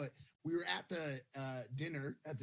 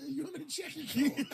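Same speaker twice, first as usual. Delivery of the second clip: higher, louder, laughing through a posh staff question; laughing mock quote; 12 semitones higher, 6 dB louder than usual. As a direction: crack up while quoting the coat check. Mocking the hotel staff, laughing.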